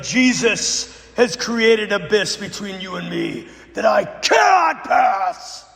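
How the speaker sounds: slow, rising, loud and firm; bold declaration. Retold or imitated. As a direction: proclaim it like a shield. Pastor's bold answer to demon.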